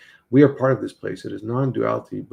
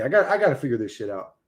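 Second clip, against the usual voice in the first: ordinary talk, nothing marked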